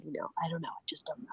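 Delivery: whispered mock uncertainty; mock hushed whisper. Mock pondering after quote.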